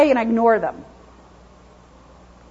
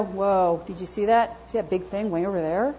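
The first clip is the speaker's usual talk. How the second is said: wary little dog; 5 semitones lower, 8 dB quieter than usual. Retold or imitated. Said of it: "he might go".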